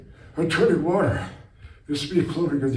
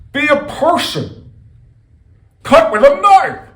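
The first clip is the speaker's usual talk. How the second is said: mock scolding shout; 13 semitones higher, 11 dB louder, slower than usual. The oracle scolding viewers about knives, shouting high. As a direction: jump high and yell the advice.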